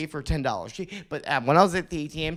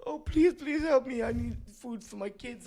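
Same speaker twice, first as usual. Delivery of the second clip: pleading beggar woman; 11 semitones higher than usual. Retold or imitated. The woman at the ferry begs the speaker for help, high pleading voice. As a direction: imitate the woman begging for food for her kids. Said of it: "and she was like".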